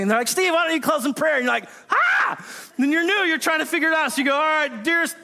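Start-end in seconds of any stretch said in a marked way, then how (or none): 0.3-1.4 higher, brighter call to Steve; cheery group leader. Retold or imitated. Small group leader calling on Steve. 1.9-2.4 sudden high startled cry; panicked yelp. Retold or imitated. Newcomer asked to pray, a high startled yelp. 4.3-5.2 fumbling prayer. Retold or imitated. Newcomer closing small group in prayer, awkward mock-formal. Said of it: "you fumble your way through it".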